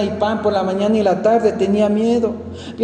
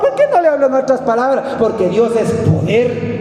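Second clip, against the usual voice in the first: raised, higher preaching voice building to a shout; fervent preaching; 7 semitones higher than usual. Acted. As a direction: preach it hard to the congregation. Preaching at full force. (predicación fervorosa, en voz alta)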